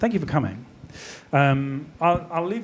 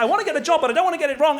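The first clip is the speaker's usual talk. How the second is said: panicked inner voice; 9 semitones higher, much faster than usual. Retold or imitated. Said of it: "I'm like".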